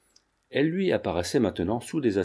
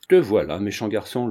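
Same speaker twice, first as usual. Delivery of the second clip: ordinary delivery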